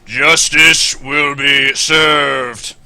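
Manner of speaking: slow, loud deadpan robot voice; flat mechanical robot announcement. Acted. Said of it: "deadpan robotic voice".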